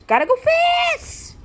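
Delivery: high, loud squeal on fast; shouted catchphrase. Acted. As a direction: yell it while dashing off. Runner calls catchphrase dashing off.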